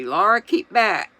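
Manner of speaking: Ma ordering her daughter away from the well, fiercely. A narrator's reading. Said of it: "Ma said fiercely".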